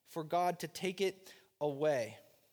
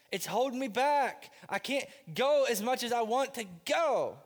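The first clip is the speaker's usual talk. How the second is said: pleading Paul; 4 semitones higher than usual. Retold or imitated. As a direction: plead with God to take the pain away. Paul begs God for healing.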